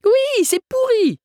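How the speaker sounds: put-on very high, loud, drawn-out child voice; squealing, merry kid; gleeful exclamation. A narrator's reading. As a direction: cheerfully shout it like a little girl. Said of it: "s'exclama gaiement l'enfant".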